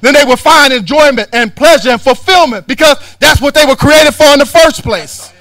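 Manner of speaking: loud, rising preacher's rebuke; preaching at full force. Acted. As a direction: hammer it home from the pulpit.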